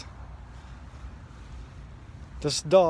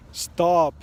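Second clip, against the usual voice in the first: louder shout of stop; retold scream; 3 semitones higher, 6 dB louder than usual. Shouting stop at a charging pit bull, full voice. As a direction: yell it to scare the dogs off. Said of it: "and I scream".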